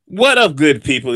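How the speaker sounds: high, loud what up; hype greeting. Acted. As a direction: kick off the segment big. Weather segment opener greeting.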